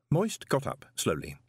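ordinary delivery, nothing marked

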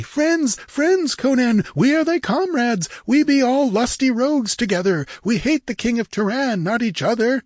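very high, placating pirate voice; high-pitched, wheedling reassurance; eager cry. A narrator's reading. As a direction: win him over eagerly. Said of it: "they cried eagerly".

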